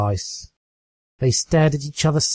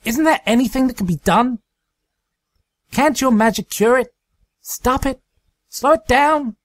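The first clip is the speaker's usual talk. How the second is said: much higher, quick and urgent; desperate pleading; 12 semitones higher, 3 dB louder than usual. Acted. Rainbow Dash begs Twilight for a cure, desperate. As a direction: beg for any other way.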